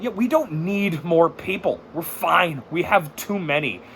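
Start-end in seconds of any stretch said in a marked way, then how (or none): none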